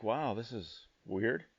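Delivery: quiet, slow, baffled murmur; bewildered aside. Retold or imitated. Stunned driver at border gate.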